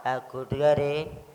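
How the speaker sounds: slow, low, hushed call; soft call. Shown as a demonstration. Leader testing whether children answer a soft call softly, slow and quiet. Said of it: "ഞാൻ പതുക്കെ വിളിച്ചാൽ".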